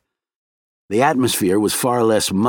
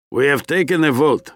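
stern; 5 semitones higher than usual. A narrator's reading. Scientist announces the group's decision to newcomer, stern. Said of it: "Arbatov said in a stern voice".

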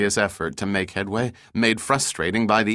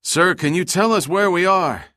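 high, raised call across water; shouted question; 9 semitones higher, 4 dB louder than usual. A narrator's reading. Rescued man asks captain where they are, shouting. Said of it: "shouted out to him".

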